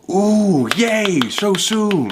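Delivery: high OOH and drawn-out YAY, delighted; pleased ooh, yay. Acted. Friend explains what he meant, OOH said with glee. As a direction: make OOH sound like good news, slow and high. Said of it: "I'm certain he means".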